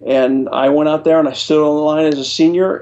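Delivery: normal talking voice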